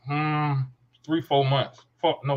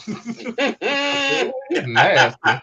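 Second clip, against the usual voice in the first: high laughing ooh, then a louder nasty; high giggling tease at a friend's flirty joke; 12 semitones higher, 5 dB louder, much slower than usual. Retold or imitated. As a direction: break into laughter mid-tease. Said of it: "I said".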